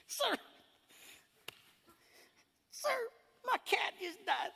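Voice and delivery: high, whiny mock sob; mock crying fit. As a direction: parody someone weeping over a pet. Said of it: "we'll get into a crying fit".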